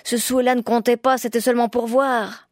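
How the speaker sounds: high, loud, desperate calling; wailing plea. A narrator's reading. Woodcutter calls the fairy back, wailing. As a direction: beg her back at full voice. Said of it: "gémit le bûcheron".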